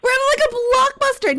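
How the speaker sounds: high, loud burst of recognition; excited outburst. Real, not acted. Excited reaction to game's setting.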